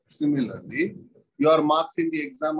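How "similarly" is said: "similarly" dropped low with a rise at the end; low rising similarly. Shown as a demonstration.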